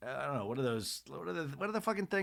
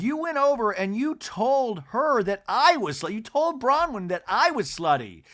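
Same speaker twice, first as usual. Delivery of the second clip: higher, louder mock accusation; accusing Angie; 9 semitones higher, 6 dB louder than usual. Imitating Angie confronting Lisa, mock outrage.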